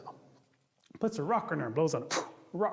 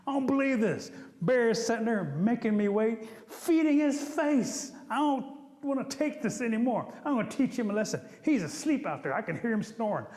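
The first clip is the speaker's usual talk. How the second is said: higher-pitched indignant rant; mad and fuming; 5 semitones higher than usual. Acted. Rabbit sulks about Bear making him wait, fuming. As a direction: fume in a high, outraged voice. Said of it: "sitting there all mean and mad and fuming".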